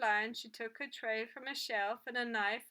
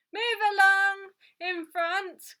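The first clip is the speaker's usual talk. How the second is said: loud, high, drawn-out shout down a queue; irate customer calling out; 9 semitones higher, 13 dB louder, slower than usual. A narrator's reading. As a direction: bellow crossly at the people ahead. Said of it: "called an irate voice".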